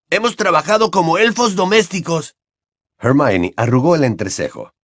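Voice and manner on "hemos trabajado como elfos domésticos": put-on a lightly raised boyish voice; Ron's voice. A narrator's reading. Ron answers Hermione in a light boyish voice. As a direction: play the cheeky teenage boy.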